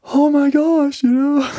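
high, gasping oh my gosh; teary gasping awe. Retold or imitated. Touched friend hears God's words, high teary gasp. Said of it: "now she's teary-eyed".

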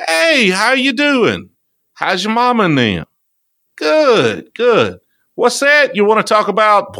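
high, slow, folksy Southern welcome; hammed-up down-home greeting; exaggerated Southern host. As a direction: greet the camera like a neighbour on the porch. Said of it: "I did this one video where I was sitting there".

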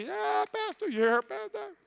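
put-on high baby voice; lightly voiced small child cheering the pastor; little child. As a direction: squeak it like a toddler in the pews. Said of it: "I heard a little baby".

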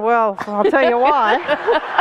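slow, knowing pharmacist aside; lightly voiced smug pharmacist; knowing look voiced. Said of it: "the look on his face was going".

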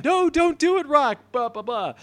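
high-pitched mock plea; Chapel. Host as Chapel trying to stop Rock, mock pleading. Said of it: "Chapel chases Rock, trying to stop him".

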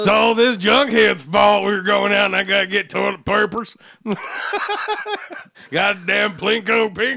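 mock angry rant. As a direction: rant like a grumpy old patriot. Imitating angry conservative.

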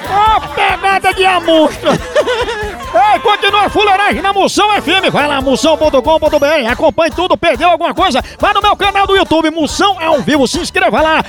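upbeat DJ voice plugging YouTube and Instagram; radio announcer promo. Acted. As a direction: pitch the channels like a radio sign-off. Host signs off with promo plugs.

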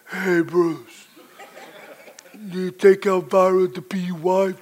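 usual speaking voice

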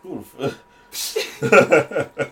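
slow, stunned laughing disbelief; drawn-out shocked half-laugh; startled. Real, not acted. Startled by sudden voice.

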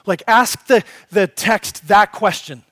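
loud, driving sermon voice, pressing each question on the congregation; full-force preaching. Acted. Preacher pressing the congregation on fearing no evil, loud and driving. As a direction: preach it hard and build, hammering each why.

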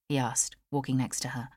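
ordinary delivery

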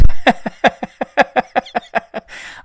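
loud burst of breathy laughter. Real, not acted. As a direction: laugh hard at your own joke. Jokes about fist pump.

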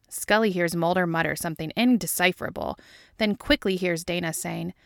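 ordinary delivery

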